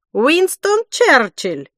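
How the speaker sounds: chef voice. A narrator's reading. Chef guessing famous names.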